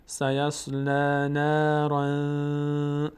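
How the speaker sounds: drawn-out chanted phrase; slow recitation. Shown as a demonstration. Teacher recites the phrase for students, chanted. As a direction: stretch it slowly. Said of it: "recites verse".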